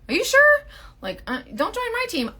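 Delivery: squeaky, high-pitched mock alarm; retold doubtful warning. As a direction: question it way up high, incredulous. Retells warning new team recruits.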